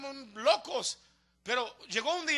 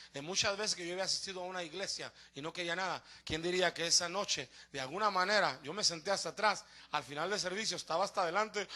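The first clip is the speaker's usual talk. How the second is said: low, soft storytelling; subdued, intimate recollection; hushed testimony; 8 semitones lower, 8 dB quieter than usual. Real, not acted. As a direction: draw the congregation in quietly. Testimony hushed before the conversion climax.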